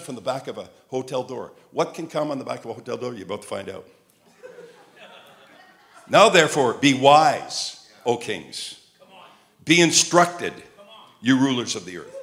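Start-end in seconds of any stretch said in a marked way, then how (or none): 6.1-12.1 loud Bible reading, falling at each phrase end; declaimed scripture. Acted. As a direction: proclaim the verse phrase by phrase.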